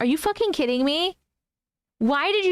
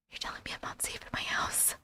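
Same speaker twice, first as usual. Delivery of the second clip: whispered in disbelief; aghast whisper; 14 dB quieter, faster than usual. Real, not acted. Streamer asks chat, horrified, in a whisper. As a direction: breathe it out in shock.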